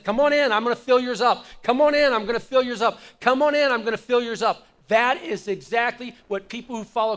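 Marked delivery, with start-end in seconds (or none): 0.0-4.7 loud, eager open-armed invitation; Jesus calling out. Retold or imitated. Preacher voicing Jesus inviting everyone in, loud and eager. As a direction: call them in warmly and loudly, full voice. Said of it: "Jesus would have been the one that would have said".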